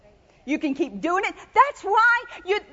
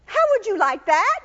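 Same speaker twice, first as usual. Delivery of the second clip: usual speaking voice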